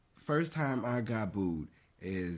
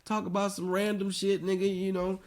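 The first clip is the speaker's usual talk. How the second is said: laughing plea; 8 semitones higher than usual. Retold or imitated. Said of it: "I was like".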